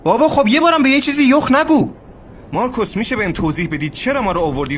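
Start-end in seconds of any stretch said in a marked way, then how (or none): 0.0-1.9 louder, higher fed-up outburst; exasperated. Acted. Robert losing patience with Marcus, fed-up outburst. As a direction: finally snap from frustration.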